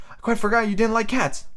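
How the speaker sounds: put-on high apologetic girlish voice; high storybook heroine; voiced Alice. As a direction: apologise as little Alice, pitched well up.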